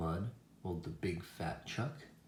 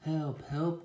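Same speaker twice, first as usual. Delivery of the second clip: high, louder storybook cry; acted plea, pitched up; crying for help; 8 semitones higher, 6 dB louder than usual. A narrator's reading. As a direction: call out as the stuck little truck. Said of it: "cried Little Blue Truck".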